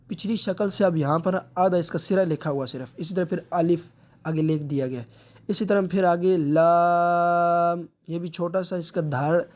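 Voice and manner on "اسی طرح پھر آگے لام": لام drawn out slowly and held; long لام. Shown as a demonstration. Teacher showing students how long to hold لام. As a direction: stretch out the letter لام. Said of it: "لام ہم نے پیچھے لمبا کیا ہے".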